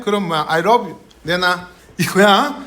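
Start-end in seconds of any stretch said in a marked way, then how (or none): 0.5-1.0 "love" said with an R, like "rob"; mispronounced L. Shown as a demonstration. Teacher imitating learners who can't say L, mispronounced L. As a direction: turn the L in "love" into an R: "rob you". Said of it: "그럼 그거는 얘인지 얘인지가 돼".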